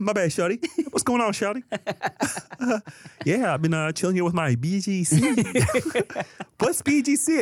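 high, lightly Kermit-style voice. Retold or imitated. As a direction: squeak it like the frog puppet. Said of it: "Kermit Does Omegle".

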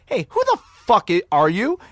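high, loud indignant demand; angry confrontation. Retold or imitated. Comedian confronts an unknown caller, angry and loud. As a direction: imitate an outraged man on the phone.

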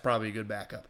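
ordinary talk, nothing marked

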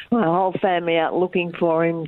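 ordinary talk, nothing marked

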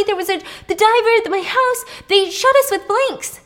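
terrified plea with a gun in her face; desperate pleading. Retold or imitated. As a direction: plead for your life, words tumbling. Begging him to drop gun.

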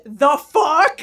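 retold shocked yell; indignant mock yell at the airport agent; yelled back. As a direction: snap it back, half shouting. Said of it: "I literally yelled that back".

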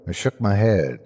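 plain reading voice

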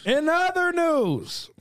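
high, slow announcer voice; news anchor voice. Pretend. Echoing newscaster phrase, far voice.

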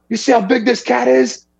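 imitated homeowner in a high, agitated voice; all fired up. Homeowner showing hunters the lion's tracks, fired up. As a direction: push the pitch way up, worked up. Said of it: "He was all fired up".

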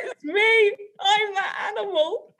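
high, slow laughing inner cry; laughing confession. Retold or imitated. In her head she admits it, laughing high. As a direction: wail it half-laughing.

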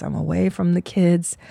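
normal talking voice

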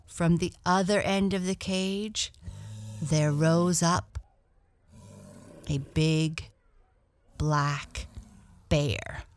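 ordinary delivery

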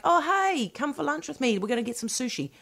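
high, light chirpy mock invite; friendly lunch invitation. Host acts out inviting a workmate to lunch, chirpy and high. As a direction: pitch it up high, softening as the casual invite goes on. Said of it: "if you're like".

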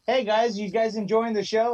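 ordinary delivery

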